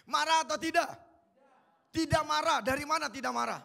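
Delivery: ordinary talk, nothing marked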